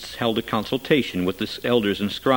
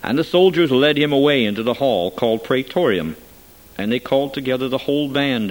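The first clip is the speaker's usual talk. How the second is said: ordinary delivery, nothing marked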